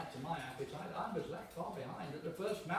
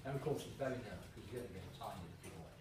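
ordinary talk

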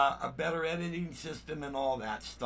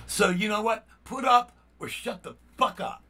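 raised, heated challenge to a troll; real anger boiling over, higher and louder; angry outburst; 6 semitones higher, 5 dB louder than usual. Real, not acted. Vlogger telling off a commenter, real angry outburst. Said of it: "I'm angry".

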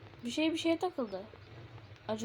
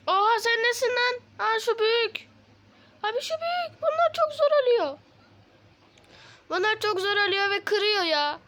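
much higher, louder panicked gamer voice; yelping in alarm as mobs break in; 9 semitones higher, 5 dB louder than usual. Real, not acted. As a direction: freak out at the monster. Monsters attack at night.

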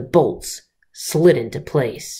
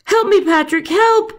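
high, loud, panicked cries; yelling for help; 4 dB louder than usual. Acted. Snake calling Patrick while the eagle carries him off, high yell. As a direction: scream for rescue as the talons close. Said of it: "he yelled".

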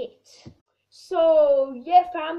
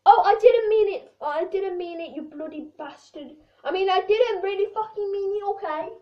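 mock heated apology; 3 semitones higher, 6 dB louder than usual.

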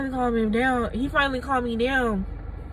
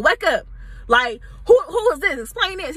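raised, higher demanding voice; angry demand; 5 semitones higher, 3 dB louder than usual. Retold or imitated. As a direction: push him to explain. Demanding an explanation.

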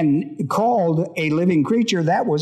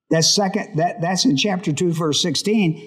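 ordinary delivery

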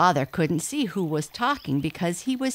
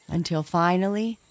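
usual speaking voice

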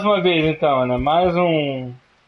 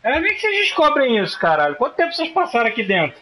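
high, fast disbelief; can't believe how they did it; incredulous outburst; 6 semitones higher, faster than usual. Real, not acted. Incredulous at other players. (incrédulo, agudo e rápido)

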